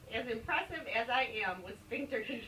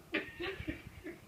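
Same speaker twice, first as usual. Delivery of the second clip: giggle; 8 semitones higher, 6 dB quieter than usual. Real, not acted. Laughing while reading.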